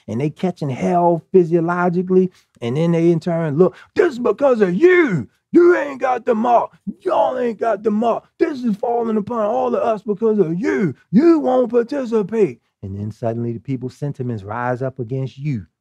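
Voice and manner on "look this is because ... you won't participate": angry accusation. Acted. Marked masses turning on the unmarked, angry accusation. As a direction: lash out in blame, pitched high. Acting out people blaming you.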